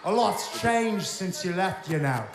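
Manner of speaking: wrestling heel in a put-on British accent, taunting; smug rock star taunting his rival in a fake British accent. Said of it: "Oh, we're doing the accent".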